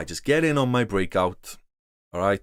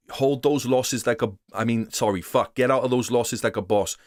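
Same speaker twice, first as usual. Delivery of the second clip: usual speaking voice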